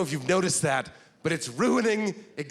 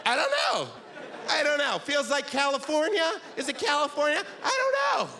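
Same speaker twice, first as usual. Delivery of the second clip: high, unsure musing; puzzled stranger; 8 semitones higher than usual. Retold or imitated. Stranger outside a bakery asked where [name] is from, high and puzzled.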